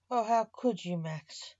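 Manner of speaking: usual speaking voice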